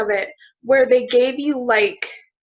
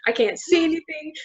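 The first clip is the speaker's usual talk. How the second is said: normal talking voice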